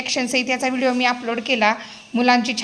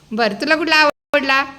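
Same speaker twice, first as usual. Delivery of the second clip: ordinary talk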